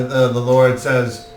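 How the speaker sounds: usual speaking voice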